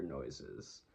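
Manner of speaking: ordinary delivery, nothing marked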